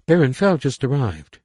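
put-on high, loud young girl. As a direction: play a bright teenage girl. Young daughter speaks.

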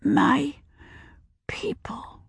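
soft fading whisper; child whispering her answer. A narrator's reading. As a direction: breathe it out.

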